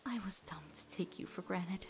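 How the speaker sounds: quiet, near-whispered regret; barely voiced apology to a friend; hushed confession. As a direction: softly admit the mistake.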